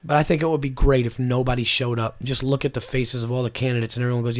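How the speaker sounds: usual speaking voice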